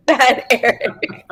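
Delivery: slow, drawn-out mock scold. Guest teasingly scolding her husband, slow and playful. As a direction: tease him like a naughty pet. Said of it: "No, I wanted to say".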